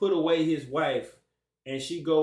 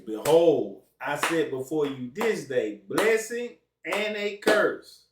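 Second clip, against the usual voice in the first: solemn scripture reading; 6 dB louder, slower than usual. Acted. Reading scripture aloud to listeners.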